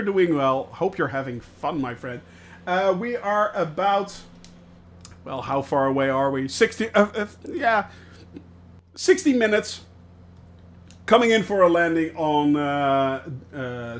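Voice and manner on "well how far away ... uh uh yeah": ordinary delivery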